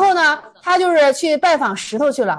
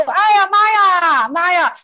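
drawn-out, higher imitated lament; mock wailing; 4 semitones higher, slower than usual. Bereaved son crying out at a funeral, mock wailing. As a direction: wail for mother like a mourning son, half in earnest. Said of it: "那孝子就在那哭".

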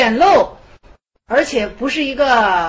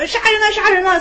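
higher, louder mock cry for help; crying murder; 8 semitones higher, 3 dB louder than usual. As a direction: yell as if being grabbed. Hermit cries murder when seized. (被抓住时高声喊“杀人了”)

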